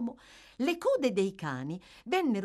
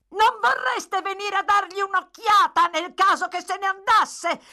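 shouting to carry; 9 dB louder than usual. A narrator's reading. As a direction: holler it up the staircase. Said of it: "gridò Brittles nella stessa maniera di prima".

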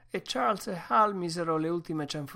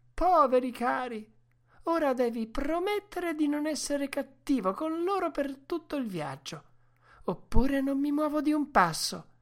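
high woman's voice, pitying then insistent; Mercedes pleading; 11 semitones higher, 5 dB louder than usual. A narrator's reading. Woman shielding the dogs from her brother, high insistent plea. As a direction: plead high, ending in a loud ultimatum. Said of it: "Mercedes intercedette gridando".

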